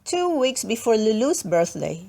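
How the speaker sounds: plain reading voice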